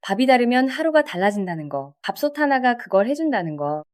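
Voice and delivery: ordinary delivery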